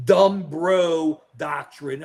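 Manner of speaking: "dumb bro" drawn out slowly; slow, weighty stress on "dumb bro"; mocking emphasis. Real, not acted. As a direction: stretch DUMB and BRO as separate beats. Coining a mocking name for doctrine.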